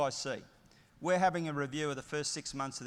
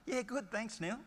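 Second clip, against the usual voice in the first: a lightly mimicked breezy audience member, higher pitch; bland polite reply; 8 semitones higher than usual. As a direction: toss off a polite brush-off. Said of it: "I guarantee you what you'll say".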